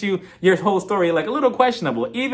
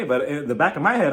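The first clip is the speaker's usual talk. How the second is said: ordinary talk, nothing marked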